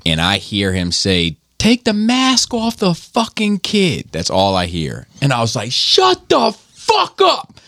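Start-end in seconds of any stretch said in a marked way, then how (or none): 1.6-4.1 high, drawn-out mock yell; imitated angry yelling. Stranger yelling at a mother about her masked kid, imitated yell. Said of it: "it's this dude yelling at my wife". 5.7-7.6 very high, drawn-out mock shout; retold angry yell. Husband defending his wife from a stranger, re-enacted yelling. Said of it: "I'm yelling at this dude".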